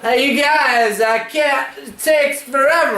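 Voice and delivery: high, whiny nasal voice; Dunbar's nasally voice. Retold or imitated. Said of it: "I could just hear Dunbar's nasally fucking voice".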